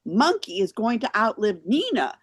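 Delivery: ordinary delivery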